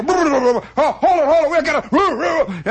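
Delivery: hammed-up bums egging each other on; high, loud, giddy boo-boo chant; excited mock boos. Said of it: "And they go".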